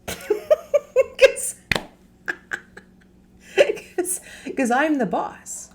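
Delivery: giggling at her own joke; laughing. Real, not acted. Laughing at being her own stressed boss. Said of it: "I always laugh too".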